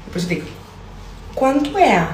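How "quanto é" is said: "Quanto é?" in a put-on carioca accent, pitched up; fake Brazilian accent. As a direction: ask the price like a carioca. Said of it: "o truque é fazer o meu sotaque brasileiro".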